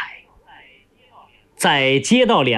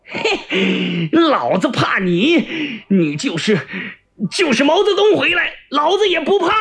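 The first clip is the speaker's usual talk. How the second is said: scornful start, climbing to a high-pitched outburst; defiant swearing; 7 semitones higher than usual. Acted. Prisoner still cursing after being attacked, defiant outburst. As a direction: curse on defiantly, pitch soaring at the end. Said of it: "继续在骂着".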